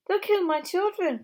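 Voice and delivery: high, loud frightened cry; fearful cry. Acted. Missus Fox fearing for her cubs, high frightened cry. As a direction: cry out in terror for the children. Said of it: "cried Missus Fox".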